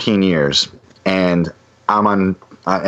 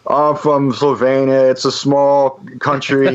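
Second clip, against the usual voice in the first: higher, slow merch guy voice; imitating Rock; 6 semitones higher, slower than usual. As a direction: play Rock reciting his stock line, slow and raised. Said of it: "and he goes".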